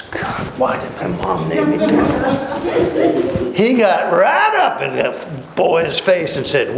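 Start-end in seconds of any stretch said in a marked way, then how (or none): 0.0-3.6 high, slow whining complaint; whiny Thomas. Retold or imitated. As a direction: whine like a sulky doubter.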